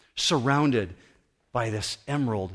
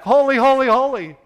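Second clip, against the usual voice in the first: high, loud answering shout; full-voiced call-and-response praise; yelling back; 13 semitones higher, 10 dB louder than usual. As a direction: shout it back like an opposing crowd. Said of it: "And they yell back".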